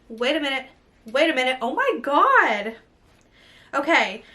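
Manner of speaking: ordinary delivery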